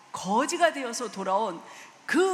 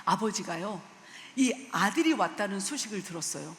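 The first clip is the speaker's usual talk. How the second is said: ordinary talk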